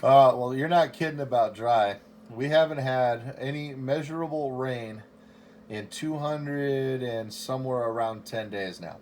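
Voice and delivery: usual speaking voice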